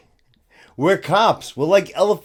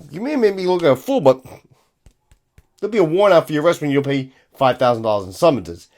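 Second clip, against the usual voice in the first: ordinary talk, nothing marked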